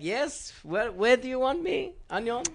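high, slow put-on answering voice; mock Sebastian. Sebastian answering the crew, high slow mock voice. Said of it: "And he's like".

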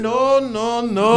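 loud, panicked-sounding refusals, hammed up; retold frantic refusal, comically loud; mock breaking down. Imitating coworker refusing a trip to Mexico, mock panic. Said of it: "He start breaking down".

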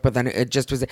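normal talking voice

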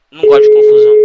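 high, loud squeal of it; mock whiny. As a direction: whine it like a fake victim. (choramingo fingido, agudo e alto)

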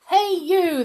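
high, loud hail; calling out. A narrator's reading. Soldiers calling to the train driver, loud hail. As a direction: hail someone from along the platform. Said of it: "they called to the driver".

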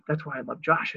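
normal talking voice

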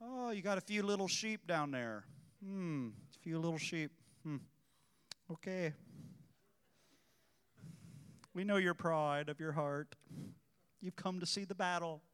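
mock pity, lightly played; condescending. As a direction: belittle the little church with a knowing hum.

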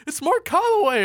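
high, loud cry of recognition; hammed-up shocked announcement; mock astonishment. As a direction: call it out high like a startled onlooker. Acts out startled crowd recognizing him.

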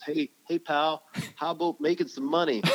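put-on chummy pitch in a hammy Canadian accent; bad Canadian accent. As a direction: attempt a Canadian accent, half laughing by the end. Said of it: "that was my worst Canadian accent ever".

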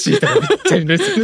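high laughing delivery; words breaking into laughter. Real, not acted. Punchline delivered while laughing.

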